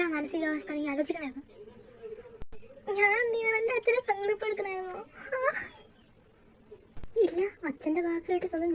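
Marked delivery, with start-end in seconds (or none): 3.0-5.5 crying. Acted. Girl crying after breakup.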